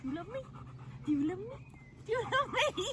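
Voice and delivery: high sing-song doggy voice. Real, not acted. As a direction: ask the dog in a squeaky coo. Cooing at the dog.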